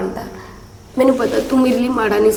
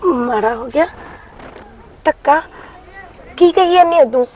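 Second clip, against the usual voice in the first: stunned disbelief; 3 semitones higher than usual. Acted. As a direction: react as if it makes no sense. Widow hears of husband's death. (ਹੈਰਾਨੀ ਨਾਲ ਉੱਚੀ ਆਵਾਜ਼ 'ਚ ਪੁੱਛਣਾ)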